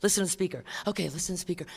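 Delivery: quietly shushing the chatty newcomer; hushed. Retold or imitated. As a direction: hush them during the meeting. Shushing newcomer during meeting.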